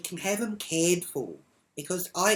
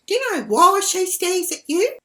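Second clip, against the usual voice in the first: loud, high mock question; high mocking impersonation of a rival; mimicked neighbour; 11 dB louder than usual. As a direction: imitate her challenge, pitched way up. Retold quarrel, mimicking the other woman.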